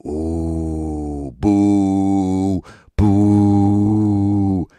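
loud booing crowd; mock crowd booing. Imitating the audience booing the husband, loud. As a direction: boo like a whole room. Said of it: "the response back from the crowd".

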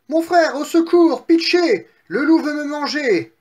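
high, loud cry for help; panicked piglet. Acted. Piglet fleeing the wolf begs brother for help, panicked. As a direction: shriek for rescue as a little pig.

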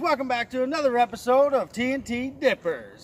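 loud, high energy opener; hyped vlog intro. Real, not acted. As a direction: pump up the crowd.

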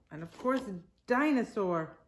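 plain reading voice

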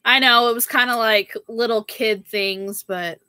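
ordinary delivery

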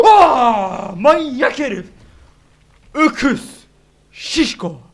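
loud, high curses at the monster; high angry yell; shouting insults. Real, not acted. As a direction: yell at the brute. Yelling insults at enemy.

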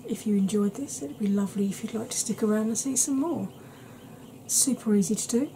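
ordinary talk

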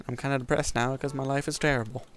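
ordinary talk, nothing marked

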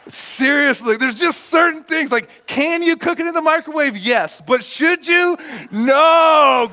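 high, loud comic indignation; playful scolding at full pitch; mock outrage. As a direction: rant in hammed-up exasperation, building to a shout. Mock outrage over microwaved steak.

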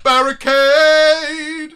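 high, loud, very slow stretched repeat; mocking drawn-out shout. Real, not acted. Streamer mocking a donor's wording with a slow shout. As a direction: stretch the word out in disbelief.

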